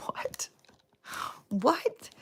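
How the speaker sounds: first gasp it, then blurt it out high; stunned double take; shocked disbelief. Retold or imitated. Shocked by neighbor's starvation remark.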